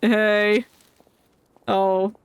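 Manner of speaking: ordinary talk, nothing marked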